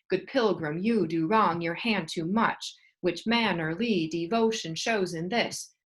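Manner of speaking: "Good pilgrim, you do wrong your hand too much" slow, beat on every other syllable; iambic recitation. Shown as a demonstration. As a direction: recite slowly, stressing every second syllable. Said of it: "every other syllable having the beat".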